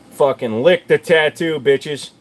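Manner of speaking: cocky slow shout. Real, not acted. Shows off his tattoo to chat.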